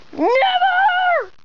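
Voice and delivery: high, drawn-out squealed never; defiant play refusal. Pretend. Patient refuses in a play scene.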